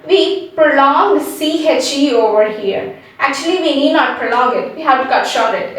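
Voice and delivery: ordinary talk, nothing marked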